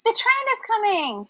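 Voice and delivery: high excited call; excited cry. Acted. Pippin sees the train coming and cries out high and excited. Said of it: "cried Pippin".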